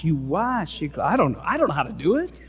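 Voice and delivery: ordinary delivery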